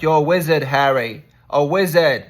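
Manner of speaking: loud, booming "You're a wizard" proclamation; mock Hagrid line. Telling Harry he is a wizard, loud and booming. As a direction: announce it grandly, like a big revelation. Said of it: "And he's like".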